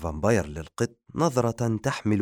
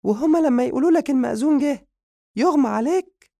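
very high, loud, fast squeaky scolding; angry Madiha; 7 dB louder than usual. Acted. Madiha scolding the vampire for fainting at the marriage officiant, high angry squeal. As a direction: shriek the accusation in a high cartoon voice. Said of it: "والغضب الذي يستعر بها".

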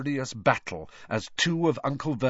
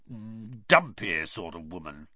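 low grudging growl; 4 semitones lower than usual. A narrator's reading. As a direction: drag it up low and sour. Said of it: "he growled finally".

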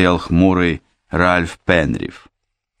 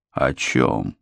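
low, guarded question; wary; 7 semitones lower, 5 dB quieter than usual. Acted. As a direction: ask it warily, low. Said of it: "насторожённо спросил юрист".

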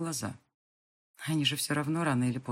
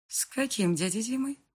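higher, puzzled question; surprised; 7 semitones higher than usual. A narrator's reading. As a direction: ask it in surprise. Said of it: "удивляется мама".

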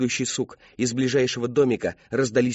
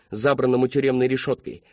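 ordinary delivery

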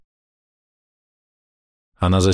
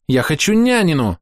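put-on stubborn pouting child; 10 semitones higher, 3 dB louder than usual. A narrator's reading. Girl refuses the adults' food, high and pouting. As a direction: pout it out stubbornly. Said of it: "упрямо дует губы Амелия".

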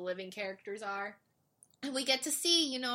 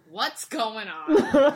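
slow laughing bewilderment; 5 semitones higher, 13 dB louder, much slower than usual. Retold or imitated. Blondie arrives confused.

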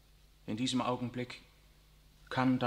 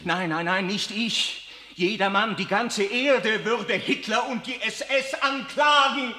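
higher and louder, slowing on the last line; feverish protest; 8 semitones higher, 7 dB louder than usual. Acted. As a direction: insist with burning conviction.

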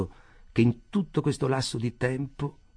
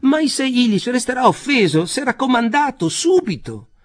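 much higher, louder fretting voice; anxious Rothschild; 13 semitones higher, 9 dB louder than usual. A narrator's reading. Rothschild begs Jacob to come, in a much higher, louder anxious voice. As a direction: plead frantically, pitch climbing.

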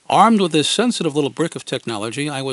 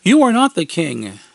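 put-on higher, louder kid retort; challenging; 4 semitones higher, 6 dB louder than usual. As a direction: throw it back as a dare. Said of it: "they challenged".

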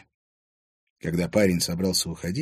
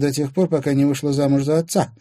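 mild, cutting put-down, higher voice; merciless; 6 semitones higher, 4 dB louder than usual. A narrator's reading. Irina taunting the woman who married her father, merciless. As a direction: deliver a pitiless jab, slightly raised. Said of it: "безжалостно заявила Ирина".

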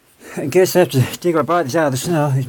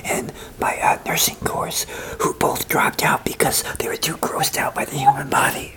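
whisper yelling; 4 dB quieter than usual. Real, not acted. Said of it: "Why are we whispering?".

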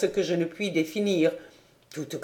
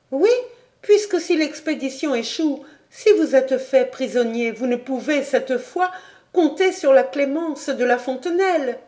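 put-on higher young woman's voice, urgent and pleading; Clara pleading; 8 semitones higher than usual. Clara begging du Granec not to leave, higher pleading voice. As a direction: beg him to stay, voice lifted.

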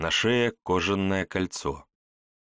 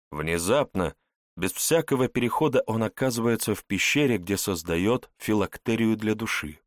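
ordinary delivery

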